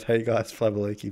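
low, soft take on a silly greeting; stupid voice. Shown as a demonstration. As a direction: toss off the daft catchphrase under your voice. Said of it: "Whenever I do a stupid voice".

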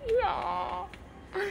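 high, very slow, stretched "いやー"; embarrassed, long drawn-out "no way"; shy drawn-out protest. Retold or imitated. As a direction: let it drag out, bashful and squirming. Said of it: "ってなりました".

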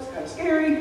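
normal talking voice

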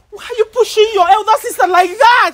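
high, loud reprimand; shocked scolding. Real, not acted. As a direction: scold loudly in outrage. Scolding her for shoving her sister.

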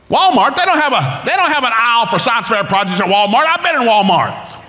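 high-pitched exasperated objection; hammed-up incredulous complaint; indignant protest. Retold protest at being sent to Walmart.

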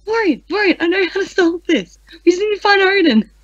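urgent, high-pitched outburst; sudden realization. Acted. Waking Thorin in the middle of the night, high and excited. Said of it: "jumps out of bed".

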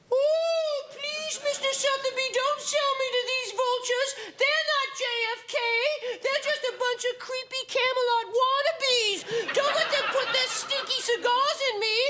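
very high, pleading object voice; talking humidor. Acted. Humidor begs Mr Sotheby not to sell it, very high and pleading. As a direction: beg like a frightened little box. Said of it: "the humidor has something it would like to say".

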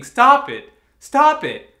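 full-force, high-pitched scream; desperate screaming. Acted. Pleading with laughing kids to stop stoning birds, desperate scream. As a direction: scream it twice at the top of your voice. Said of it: "and I kept screaming".

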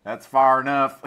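loud gunman's call to halt; shouted warning. Acted. Gunman shouting at the intruder, loud warning. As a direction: bark the halt order from cover. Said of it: "a voice shouts out".

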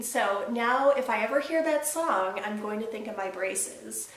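usual speaking voice

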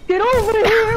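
loud, high battle cry; shouted taunt. Acted. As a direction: yell it like a fighting-game taunt. Game taunt shouted at opponent.

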